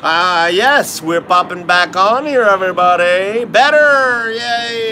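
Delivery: loud, high, cheerful welcome back; excited greeting. Real, not acted. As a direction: greet everyone with a burst of energy. Greeting viewers back on air.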